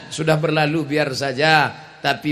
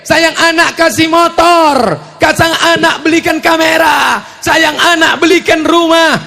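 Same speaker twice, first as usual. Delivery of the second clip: preaching shout; 10 semitones higher, 9 dB louder than usual. Acted. Scolding spoiling parents.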